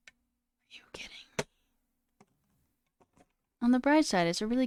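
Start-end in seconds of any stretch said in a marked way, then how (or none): 0.1-1.5 exasperated whisper, keeping it down; annoyed whisper. Real, not acted. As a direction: hush it so the roommate can't hear. Whispering about roommate's showers.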